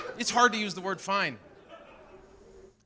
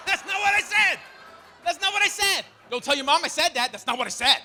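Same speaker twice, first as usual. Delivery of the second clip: high, loud flustered protest; panicked denial; 12 semitones higher, 4 dB louder than usual. Retold or imitated. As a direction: deny it in a panic. Dad denies to son.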